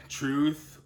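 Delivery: ordinary talk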